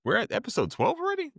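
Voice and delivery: real surprise, high and quick; shocked disbelief. Real, not acted. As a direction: squeak out the surprise. Surprised at episode count.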